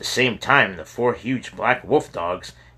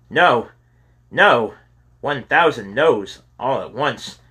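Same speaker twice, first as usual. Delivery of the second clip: loud refusal; 4 semitones higher, 5 dB louder than usual. Acted. Kyle refuses James's dare.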